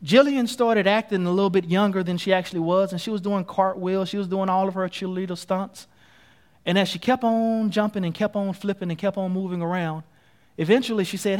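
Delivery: ordinary talk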